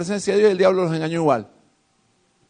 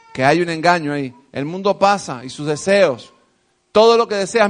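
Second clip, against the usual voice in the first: ordinary delivery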